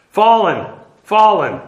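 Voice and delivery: each fallen spoken weighty and final; solemn emphasis. Shown as a demonstration. Teacher reading the prophecy with solemn finality. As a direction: read Fallen, fallen like a solemn proclamation. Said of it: "it's repeated for emphasis".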